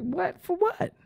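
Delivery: shocked high squeak of disbelief; imitating his own stunned cry; retold disbelief. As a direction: jump way up in pitch, stunned. Retold shock at jail news.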